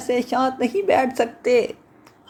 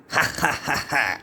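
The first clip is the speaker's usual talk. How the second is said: deep chuckle; 12 semitones lower, 4 dB quieter than usual. Acted. Suitor laughing.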